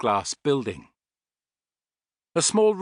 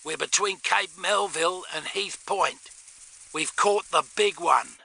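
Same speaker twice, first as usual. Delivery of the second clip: higher-pitched Australian through a walkie-talkie; crackling radio voice; 6 semitones higher than usual. A narrator's reading. Tony reporting the catch to Astrid, radio voice. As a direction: report it as a filtered radio message. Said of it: "crackled the receiver".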